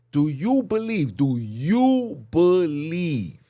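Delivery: YOU and BELIEVE stretched out high and loud; emphatic questioning. Real, not acted. Preacher challenging listeners about where they were, emphatic questioning. Pressing the congregation with repeated question.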